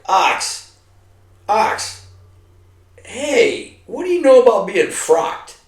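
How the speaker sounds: high calling voice, hey ox. Retold or imitated. Buddy calling him across the gunnery range, high.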